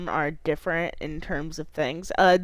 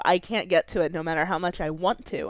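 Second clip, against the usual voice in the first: normal talking voice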